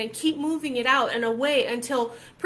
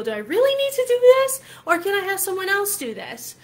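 high, loud mock protest; acted protesting question; 8 semitones higher, 6 dB louder than usual. Coach voices herself questioning a chore, high mock protest. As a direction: pitch leaps way up, pleading to be let off. Acts out questioning an unwanted task.

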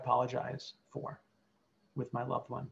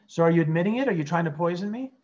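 accusing patient; 3 semitones higher, 7 dB louder, faster than usual. Acted. Role-played patient accuses therapist.

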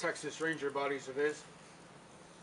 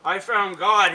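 loud, higher-pitched quoted proclamation; ringing testimony, punched out loud; proclaiming; 4 semitones higher, 11 dB louder, slower than usual. Acted. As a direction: declare it out loud like a convert's testimony. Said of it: "he used to say".

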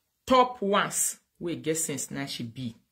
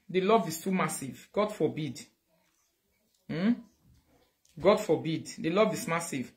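usual speaking voice

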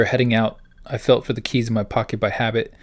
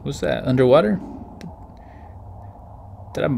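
normal talking voice

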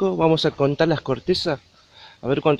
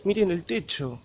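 ordinary talk, nothing marked

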